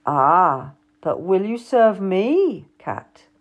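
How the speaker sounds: slow, smooth and coaxing; silkily. A narrator's reading. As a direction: draw her in smoothly, a touch sly. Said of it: "George asked silkily".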